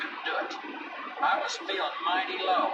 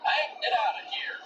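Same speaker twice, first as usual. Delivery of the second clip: yelling; 7 semitones higher than usual. Acted. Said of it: "he started yelling".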